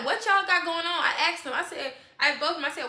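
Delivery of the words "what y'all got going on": raised, louder retold question; suspicious demand. Woman retelling how she questioned the pair, suspicious. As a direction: press them both for an answer.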